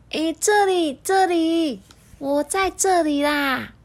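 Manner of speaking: high, slow calling out. Acted. The schoolbag calling to the boy from the next room, high.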